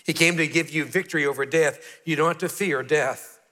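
ordinary talk, nothing marked

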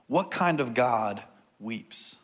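drawn out, with a pause before the last word; slow, weighty question. Real, not acted. Preacher posing an important question to congregation, slow and weighty. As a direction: pose it slowly and let it hang. Poses key question, then repeats it.

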